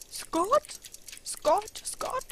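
high, loud, musing repetition; old man racking his memory; puzzled old clockmaker. Acted. Clockmaker tries to place a name, high puzzled voice.

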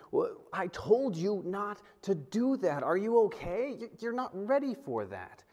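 lightly voiced stern scolding of a child; a hint of a worried, stern father; a stern but caring father checking on his daughter; stern parent. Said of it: "I was sternly saying".

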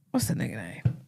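soft whisper to herself, trying to recall a name; whispered aside. Real, not acted. Host whispers to herself while trying to recall a name. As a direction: breathe it under your breath, searching memory.

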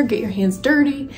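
normal talking voice

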